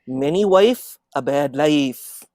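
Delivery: put-on father's Desi accent, loud and emphatic; Indian accent. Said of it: "I'm gonna use his accent".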